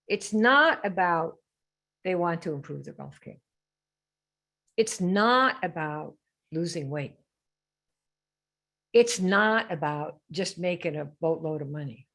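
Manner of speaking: slow, deliberate, with weight on not; slow emphatic denial. Acted. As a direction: spell out each point slowly so it sinks in. Teacher stressing the real point.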